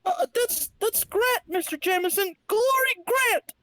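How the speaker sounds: high, indignant secretary correcting her boss; falsetto secretary. Acted. Glory corrects her boss Jameson, in falsetto. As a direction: squeal out her own name.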